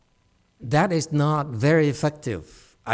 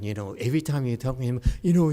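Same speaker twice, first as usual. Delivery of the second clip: ordinary talk, nothing marked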